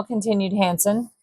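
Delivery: ordinary delivery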